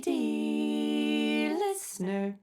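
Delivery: very slow, stretched sing-song show title; drawn-out title echo. Pretend. Co-host echoing the show name back, slow and stretched out. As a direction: drag each word out like a grand announcement.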